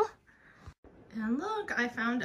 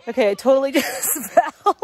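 laughing; 3 semitones higher, 9 dB louder than usual. Real, not acted. Laughs after falling on course.

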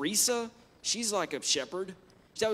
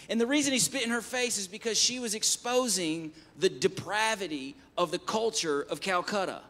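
ordinary delivery

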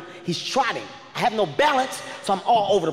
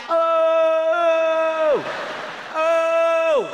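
friends yelling; 8 semitones higher than usual. Retold or imitated. Friends behind him calling out, high yelled oh. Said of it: "They're like".

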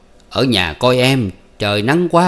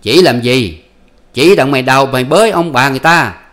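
high, loud bickering voices; husband and wife arguing at night, high and loud; heated quarrel; 6 semitones higher, 5 dB louder than usual. Acted. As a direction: snap back and forth, pitch raised. Voiced marital quarrel continues.

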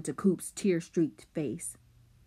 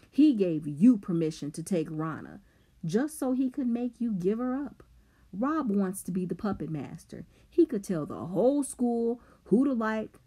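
raised, higher-pitched, accusing tirade; taunting boy; 5 semitones higher, 4 dB louder than usual. A narrator's reading. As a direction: drive the accusation home, punching key words. Simon exposes Rob's manipulation.

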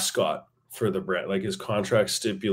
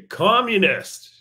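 high, loud, drawn-out call from upstairs; mock call-out; 9 semitones higher, 6 dB louder, slower than usual. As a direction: holler it slowly down to the street. Re-enacting the shout from upstairs.

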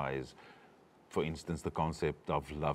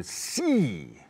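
C drawn out long on its vowel; elongated E; 8 semitones higher than usual. Shown as a demonstration. Coach shows the exclaimed word, C elongated on the ee. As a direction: stretch the ee in C. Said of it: "elongate the E".

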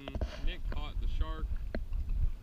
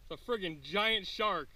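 drawn-out, high-pitched amazement; real excitement; 6 semitones higher, slower than usual. Real, not acted. Angler spots a giant shark, excited. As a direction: stretch it out, thrilled and higher. Sees the big fish on the line.

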